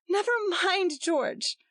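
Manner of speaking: high soothing plea; pleading. A narrator's reading. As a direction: beg him gently to leave. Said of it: "pleaded Gretchen".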